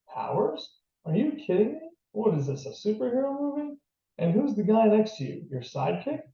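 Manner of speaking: high, sharply rising disbelieving questions; incredulous. A narrator's reading. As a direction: scoff in disbelief, pitch leaping up at question ends. Nurse scoffs at superhero claim.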